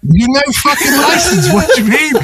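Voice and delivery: high, laughing mock disbelief; incredulous. Learner driver to his unlicensed friend, incredulous. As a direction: act out stunned disbelief through a laugh, pitched high. Retelling his shock at the confession.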